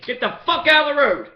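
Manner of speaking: a retold yell at a driver; mock shout. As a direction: bark it back like the cops did. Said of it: "Same shit they said to me".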